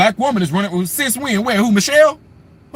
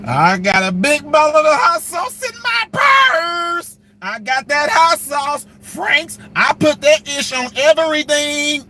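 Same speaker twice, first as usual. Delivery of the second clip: higher, slow, hammed-up drawl; mock Hillary boast; 4 semitones higher, slower than usual. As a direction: boast like she's one of the folks. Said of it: "you know what else".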